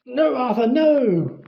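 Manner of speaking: wife shrieking at her husband to stop fighting. A narrator's reading. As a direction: cry out to stop him. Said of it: "Mister Weasley was shrieking".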